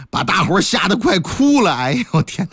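usual speaking voice

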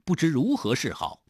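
ordinary delivery

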